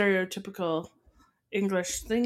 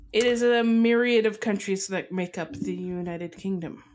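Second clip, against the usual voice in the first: normal talking voice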